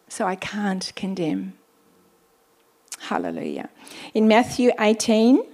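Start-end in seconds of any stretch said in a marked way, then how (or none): 0.1-1.5 a hushed, unvoiced confession; whisper. Real, not acted. Whispered aside in sermon. 3.0-3.7 soft hallelujah. Real, not acted. Quiet exclamation after whisper.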